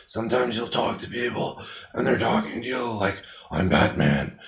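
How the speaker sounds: low, soft, rumbly Batman imitation. Instructor imitating a hoarse patient for students, deep Batman rumble. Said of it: "that's a deep, rumbly voice".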